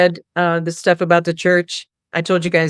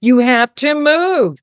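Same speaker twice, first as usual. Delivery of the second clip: mock yell; 5 semitones higher, much slower than usual. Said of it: "screaming in the ear".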